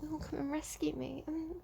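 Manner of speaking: breathy, high, coy plea; coquettish. Retold or imitated. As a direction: purr it out like a tease. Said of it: "Very coquettish".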